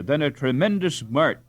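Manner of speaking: ordinary delivery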